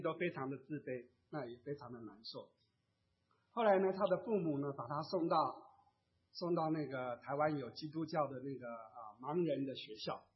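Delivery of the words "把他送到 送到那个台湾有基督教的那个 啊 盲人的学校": ordinary delivery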